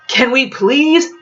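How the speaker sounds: slow exasperated sigh; worn-out plea. A narrator's reading. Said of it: "Edie sighed".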